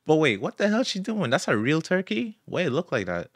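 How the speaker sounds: sudden high disbelief; high shocked outburst at the turkey. Real, not acted. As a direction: jump up in pitch, stunned.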